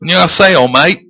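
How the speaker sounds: Korean in a mock Australian accent; joking Aussie-accented Korean greeting. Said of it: "speak Korean with an Australian accent".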